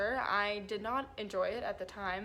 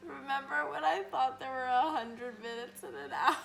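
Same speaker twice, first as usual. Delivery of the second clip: laughing; 3 semitones higher than usual. Real, not acted. Giggling delirious question.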